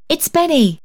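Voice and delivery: put-on high little girl's voice, falling at the end; child's cry. A narrator's reading. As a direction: call out the name like a child. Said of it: "cried the child".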